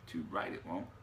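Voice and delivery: low Cockney gremlin voice; guttural Cockney murmur. A narrator's reading. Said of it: "murmured a guttural little Cockney voice".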